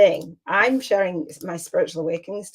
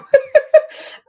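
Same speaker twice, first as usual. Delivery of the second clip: a sudden high, loud laugh; loud burst of laughter; 8 dB louder than usual. Real, not acted. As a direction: laugh out loud at how absurd it sounds. Amused at the suggestion.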